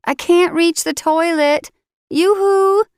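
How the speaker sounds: a captive woman calling out for attention; heroine calling out. Acted.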